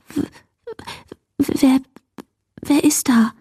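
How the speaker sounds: stammering, teeth-chattering whisper; frightened whisper. A narrator's reading. Scared girl calls to the cupboard, whispering with chattering teeth. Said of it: "flüsterte sie schließlich mit klappernden Zähnen".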